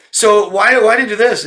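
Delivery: high, loud mock whine; mocking a clueless asker. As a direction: parrot the dense question back.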